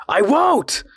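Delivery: very high, loud defiant shout. A narrator's reading. The man refusing the voice, a high defiant shout. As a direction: hurl the refusal out. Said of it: "he shouted again".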